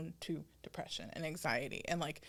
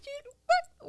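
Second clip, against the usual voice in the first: very high, playful, laughing dog-owner scold; 5 dB louder than usual. Retold or imitated. Owner catching her dog misbehaving, high playful scolding. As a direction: scold a naughty dog with love. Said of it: "I was literally thinking of, of the dog".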